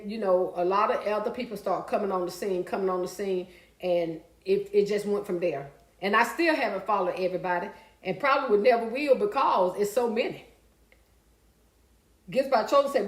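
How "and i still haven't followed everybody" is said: usual speaking voice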